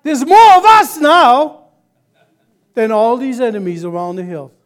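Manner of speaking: put-on young man astonished at the unseen army, excited; amazed excitement. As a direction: shout it like you suddenly see the army. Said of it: "He says".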